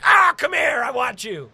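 loud, high growly cry, then easing; hammed-up shouted come-on; mock lustful yell. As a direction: holler it like a comic pounce. Mock lustful lunge at friend.

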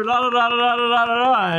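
high sing-song filler babble; mock cop babble. Mocking the cop's rambling, high sing-song. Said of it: "they're like".

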